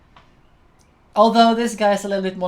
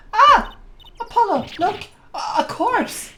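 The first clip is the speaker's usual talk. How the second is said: high, loud gasp into stammered alarm; startled alarm; 13 semitones higher, 4 dB louder than usual. Acted. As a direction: cry out in acted shock at a grisly find. Game girl discovers a corpse.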